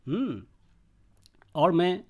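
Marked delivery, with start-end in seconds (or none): none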